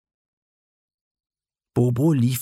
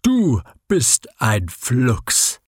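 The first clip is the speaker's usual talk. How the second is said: slow, puffed-out words; worn-out snail panting after the race, very slow; puffing snail; much slower than usual. Acted. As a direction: gasp it out like an exhausted snail. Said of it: "schnaufte aus dem letzten Schneckenhausloch".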